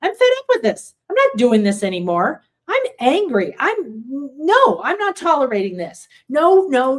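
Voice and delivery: acted anger, voice spiking up and loud; demonstrating blowing up in frustration; getting angry. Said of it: "And you really get angry".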